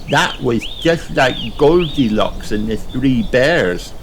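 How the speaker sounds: put-on high, slow little girl voice, amazed; drawn out in childish awe; Peppa in wonder. A narrator's reading.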